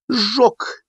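very high, chirpy one-word answer; blithe, gleeful admission; cheerful. A narrator's reading. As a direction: chirp it out merrily. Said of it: "весело пояснил Гурий Михайлович".

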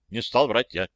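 ordinary delivery

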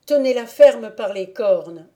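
put-on a young valet ordering firmly; firm loud order. A narrator's reading. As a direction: bark it across the stable. Valet orders holding the goat.

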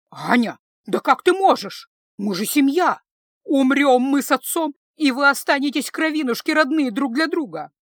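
high, loud outraged pleading; indignant appeal. A narrator's reading. Mother begging her daughter to think of family, agitated and loud.

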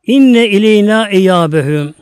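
slow, loud Arabic recitation; recited verse. Acted. Preacher intoning verse to congregation, slow and loud.

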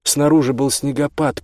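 ordinary delivery

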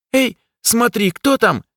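high squeaky voice. A narrator's reading. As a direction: squeak it out suddenly. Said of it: "вдруг пропищал из-за спины Блик".

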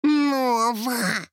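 high fairy rebuke; high-pitched, reproving exclamation; hissed reproach. A narrator's reading. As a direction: scold her in a high little voice. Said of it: "зашипела Лала".

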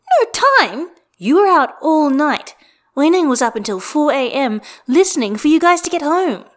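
high, loud indignant scolding. Acted. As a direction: scold him loudly in disbelief. Roommate scolding loudly.

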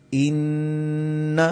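اِنَّ held long through the nose; nasal ghunna. Shown as a demonstration. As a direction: hum the نّ in اِنَّ. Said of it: "غنہ آیا".

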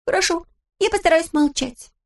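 put-on high, lively girl's voice; Pippi voice. A narrator's reading. Narrator voicing Pippi, high and perky. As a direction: play Pippi as a cheeky girl.

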